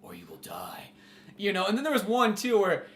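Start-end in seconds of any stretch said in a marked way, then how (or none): 0.0-0.9 low, soft, sinking to a whisper; mock-ominous murmur, ending whispered; ominous billboard voice. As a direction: let it fade into a hushed threat.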